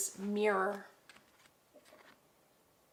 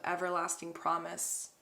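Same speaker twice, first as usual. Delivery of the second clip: ordinary talk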